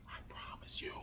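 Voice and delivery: whispered promise. Real, not acted. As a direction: breathe it as a secret. Whispered aside.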